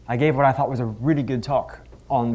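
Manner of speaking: ordinary talk, nothing marked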